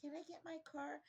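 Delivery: high, soft imitation of a woman on the phone; small, high pleading voice; woman's voice. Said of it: "I hear a woman's voice".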